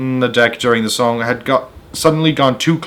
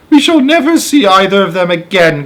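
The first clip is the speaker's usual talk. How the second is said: higher, louder anguished cry; 7 semitones higher, 5 dB louder than usual. Acted. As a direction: wail that they are lost. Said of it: "cried the ladybug".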